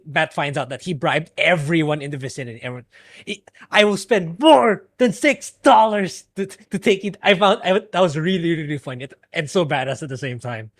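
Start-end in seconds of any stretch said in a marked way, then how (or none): 3.7-7.2 loud, slow emphatic outburst; mock furious retort, raised in pitch; Kingpin. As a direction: thunder it word by word. Quotes Kingpin's angry retort.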